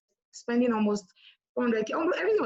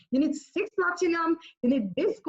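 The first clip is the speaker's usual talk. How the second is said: higher-pitched take on a mentor; a hint of the boss's voice, higher; imitating upline's talk; 7 semitones higher than usual. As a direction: lightly mimic the upline, pitched up. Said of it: "you know the way she talks".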